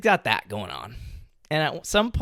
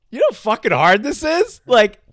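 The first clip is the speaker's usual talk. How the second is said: exasperated; 6 semitones higher, 6 dB louder than usual. Real, not acted. Exasperated emphasis on odds.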